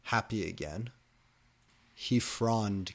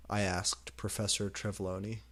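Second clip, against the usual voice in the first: ordinary delivery, nothing marked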